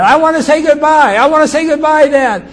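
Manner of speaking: very high, loud desperate shout; screamed plea. Retold or imitated. Child screaming to his dad for a last goodbye, desperate scream. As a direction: scream it pleadingly to someone getting away. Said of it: "I screamed to Dad".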